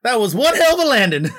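high, loud whooping cheer; big whoop of relief; excited whoop. Acted. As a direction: celebrate the landing out loud. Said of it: "I walk out and I'm like".

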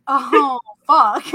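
drawn-out oh-no reaction with a laugh; retold dread. Dreamer spots a giant woman coming at her, mock alarm.

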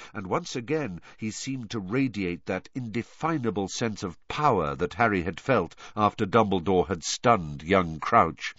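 ordinary delivery, nothing marked

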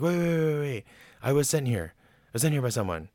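high, stalling protest; acted-out panic, starting high and easing down; panicked pleading. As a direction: plead for time as a cornered mouse. Mouse pleads before being eaten.

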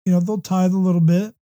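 normal talking voice

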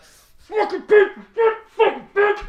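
high, loud, slow muffled rage; pillow scream. Pretend. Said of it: "Scream it out of ya".

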